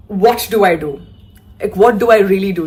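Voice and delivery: loud, panicked what-do-I-do. Retold or imitated. Telling her sisters on the phone, loud and panicked. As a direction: blurt it out in a panic.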